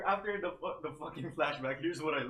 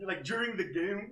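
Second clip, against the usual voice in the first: ordinary talk, nothing marked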